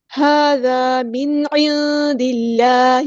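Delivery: slow, drawn-out Quranic recitation; Tajweed recitation. Shown as a demonstration. Teacher models the verse word, slow Tajweed recitation. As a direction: recite slowly, holding each long vowel.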